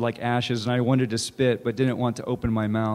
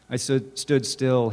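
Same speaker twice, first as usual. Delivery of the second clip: plain reading voice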